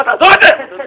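high, loud urgent command; shouted order. Retold or imitated. Worshipper grabbing a visitor's hand, shouting an urgent order. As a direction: imitate someone yelling to let go.

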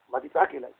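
"מה תצעק אליי" said soft and low; quiet repeat. Shown as a demonstration. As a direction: mutter the harsh verse quietly to weigh it. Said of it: "האכזרי משהו". ("מה תצעק אליי" בשקט ובקול נמוך)

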